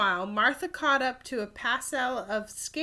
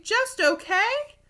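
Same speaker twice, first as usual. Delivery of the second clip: incredulous; 12 semitones higher, 5 dB louder, slower than usual. Acted. Rob disbelieving.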